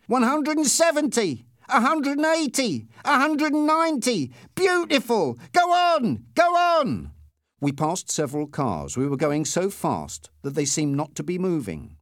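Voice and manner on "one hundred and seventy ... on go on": very high, thrilled yelling; excited shouting. A narrator's reading. Passenger egging the driver on, excited shouting. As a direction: build to a high, loud urging. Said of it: "my passenger shouted".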